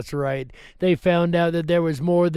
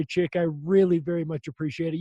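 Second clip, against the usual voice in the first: ordinary delivery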